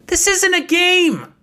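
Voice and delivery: high, loud outburst. Acted. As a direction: cut them off with a shout. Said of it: "Nicolette raised her voice".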